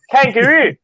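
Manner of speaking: laughing at his own bad Australian "Kangaroo"; Australian accent attempt. Shown as a demonstration. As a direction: push "Kangaroo" up high in a mock Aussie voice. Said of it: "I can't even do it in Australia".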